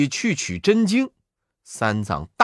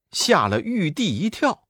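ordinary delivery

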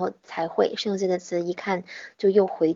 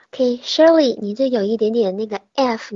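ordinary delivery

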